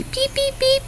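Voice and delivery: put-on high, soft baby bird peeps; chick peeping. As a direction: peep like a tiny hungry chick. Voicing noisy baby birds.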